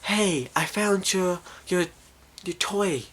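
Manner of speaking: put-on slow, high, soft girlish ghost; creepy ghost voice. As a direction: lure softly in a high ghost voice. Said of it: "the creepy ghost voice says".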